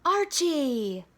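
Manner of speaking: put-on boy calling his friend's name. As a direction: call a friend's name across the yard. Calling out from the hideout.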